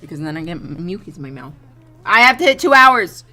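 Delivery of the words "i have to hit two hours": loud, high outburst; emphatic shout. Real, not acted. Streamer set on reaching stream length, emphatic shout. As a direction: belt it out as an insistent protest.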